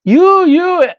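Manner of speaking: German man calling out across a convention hall. Retold or imitated. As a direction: call across the hall to grab his attention. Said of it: "he says".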